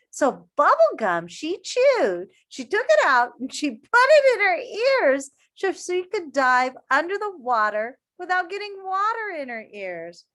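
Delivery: high, loud eager retelling; animated storytime amazement; excited. Acted. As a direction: sell the wild detail to the kids.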